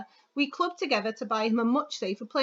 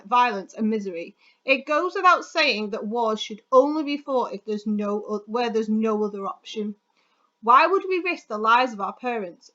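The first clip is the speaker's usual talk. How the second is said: persuasive reading; 4 dB louder than usual. Acted. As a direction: read it like a rousing persuasive speech. Reads model persuasive article aloud.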